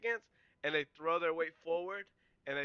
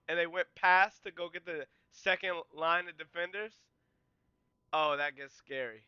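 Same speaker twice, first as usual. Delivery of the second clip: ordinary delivery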